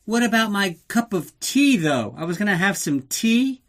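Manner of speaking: hammed-up pouting girlfriend; mock whiny Tanya. Narrator mocking Tanya fussing over her tea, whiny.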